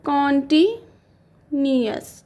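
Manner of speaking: stretched slowly syllable by syllable; slow dictation. Shown as a demonstration. Teacher dictating the tense name, slowly. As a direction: draw it out for writing down. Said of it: "spelling सही होनी चाहिए guys".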